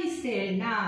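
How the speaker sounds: slow, deliberate syllable-by-syllable reading; slow recitation. Acted. Teacher reciting the alphabet table slowly to students. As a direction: spell it out slowly for young pupils.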